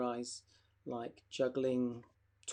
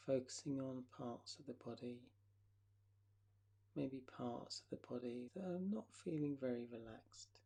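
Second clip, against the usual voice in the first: guided relaxation. Acted.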